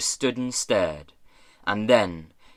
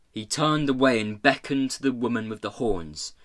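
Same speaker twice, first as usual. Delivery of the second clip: usual speaking voice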